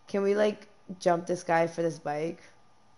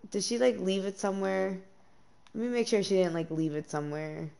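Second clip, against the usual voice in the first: ordinary delivery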